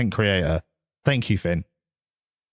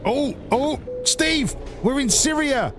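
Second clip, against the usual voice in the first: excited call to dog; 10 semitones higher than usual. Real, not acted. Startled call to in-game dog.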